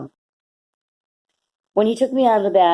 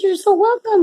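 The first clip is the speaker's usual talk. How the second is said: cheery stranger's greeting; 12 semitones higher than usual. Retold or imitated. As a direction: call it out like a beaming stranger. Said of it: "He said".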